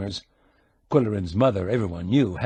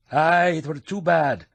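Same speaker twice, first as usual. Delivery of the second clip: stronger Scottish brogue; 6 semitones higher than usual. A narrator's reading. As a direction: lean hard into the brogue on Aye. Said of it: "putting on the brogue".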